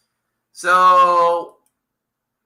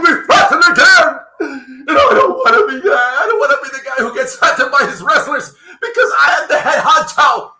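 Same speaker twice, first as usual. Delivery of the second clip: whiny mock boss rising to loud sob-shout; a hammed-up scared boss whining about being hit; mocking Tony Khan; 4 semitones higher, 5 dB louder than usual. Podcaster mocking a wrestling boss, loud whiny sobbing. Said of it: "decides to".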